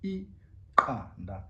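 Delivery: Iqanda said very slowly with a click on q; isiXhosa click. Shown as a demonstration. Teacher modelling Iqanda slowly, click pronounced. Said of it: "An egg in isiXhosa is".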